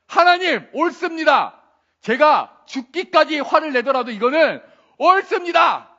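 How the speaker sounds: loud, high, defiant acted shout; angry outburst. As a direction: flare up at God as the stubborn prophet. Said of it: "그러면서 버럭 하나님께 화를 냅니다". (버럭 화내며 소리치는 요나 연기)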